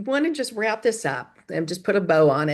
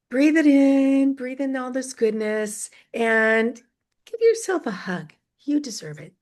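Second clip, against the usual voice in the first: guided breathing; 3 semitones higher than usual. Acted. Host guiding the ladies to breathe and hug themselves, calm and gentle. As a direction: lead the listeners through one calm breath. Leads a short breathing moment.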